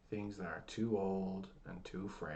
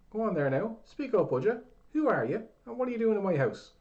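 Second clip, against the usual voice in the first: put-on demanding old man; 13 semitones higher, 12 dB louder than usual. A narrator's reading.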